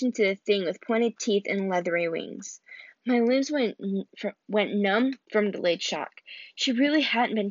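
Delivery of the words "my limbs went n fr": plain reading voice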